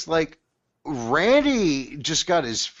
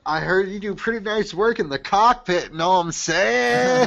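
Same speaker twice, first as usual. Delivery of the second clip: imitated J-Rock; 8 semitones higher than usual. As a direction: mimic a character with a stretched, high finish. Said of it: "J-Rock comes up".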